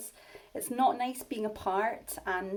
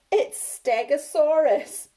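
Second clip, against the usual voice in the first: loud, slow high gloat; gloating laugh; 6 semitones higher, 11 dB louder, slower than usual. Acted. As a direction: crow about the trick. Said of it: "Bonehead laughed".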